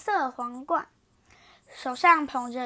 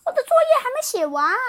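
high, loud, fast bunny voice; little rabbit; 10 semitones higher, 8 dB louder, faster than usual. Acted. Rabbit excusing herself, high and quick. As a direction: rattle it off like a hurried rabbit.

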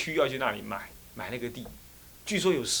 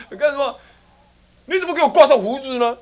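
high, loud accusing question; loud, high-pitched scolding; indignant demand; 11 semitones higher, 9 dB louder than usual. Acted. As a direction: demand an explanation, outraged. Acted quarrel over beard.

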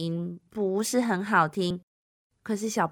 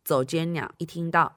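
ordinary delivery, nothing marked

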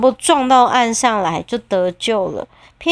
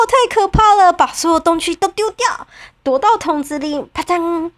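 frightened cat; 7 semitones higher than usual. Acted. 喵喵害怕躲进桶里.

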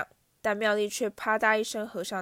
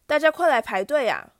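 loud taunting yell at the crowd; jeering shout; 5 semitones higher, 8 dB louder than usual. Acted. Bully shouting to the courtyard crowd, loud jeering yell. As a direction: bellow it out to mock Harry. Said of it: "马粪对着人群吼道".

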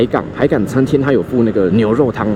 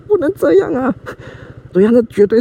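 much higher, aghast; shocked protest; 12 semitones higher than usual. Retold or imitated. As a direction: squeal it out in disbelief. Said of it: "那个服务生反应跟我一样".

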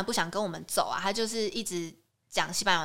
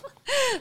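high laugh. Real, not acted. Laughs at the scam story.

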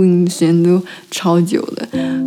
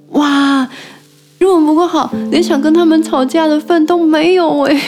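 frustrated venting; 7 semitones higher than usual. Real, not acted. Venting about being bullied at work.

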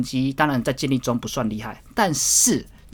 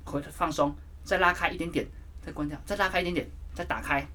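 higher, softer coaching count of the reps; coaching cues; 4 semitones higher, 5 dB quieter than usual. Shown as a demonstration. Guiding viewers through the rear fly, softer coaching cues. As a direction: coach yourself through each rep. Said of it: "他跟我讲这个动作".

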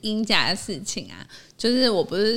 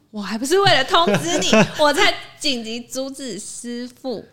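high, loud, laughing indignation; exasperated protest; 5 semitones higher, 7 dB louder than usual. Retold or imitated. Venting to a co-host about the neighbour's complaint, exasperated. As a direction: protest at being blamed, pitch jumping up.

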